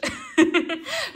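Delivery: a high burst of real laughter; bright laugh. Real, not acted. Reader laughing at skeptical clients, high loud laugh.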